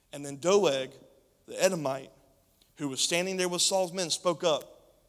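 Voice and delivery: ordinary delivery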